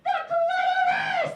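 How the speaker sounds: very high, loud, slow yell; triumphant group shout. Retold or imitated. Every girl guessing together, high triumphant shout. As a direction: scream the answer in triumph. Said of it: "is like".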